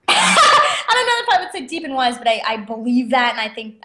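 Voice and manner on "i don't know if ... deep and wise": high, giggling disclaimer; laughing it off. Real, not acted. Guest shrugging off the host's praise, laughing. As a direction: laugh through the modest denial.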